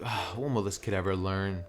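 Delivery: low, soft sigh to oneself; hushed, resigned aside; weary inner thought. Acted. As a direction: think aloud, quietly exasperated. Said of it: "just sitting there just thinking like".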